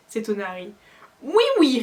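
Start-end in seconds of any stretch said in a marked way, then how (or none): 1.2-1.8 high, loud eager agreement; furious elf. A narrator's reading. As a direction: nod furiously while squeaking. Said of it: "en hochant furieusement la tête".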